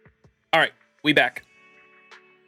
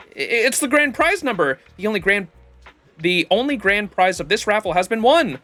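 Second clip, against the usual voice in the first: booming prize announcer; 6 semitones higher than usual. Acted. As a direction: announce the winner proudly. Reads raffle announcer lines.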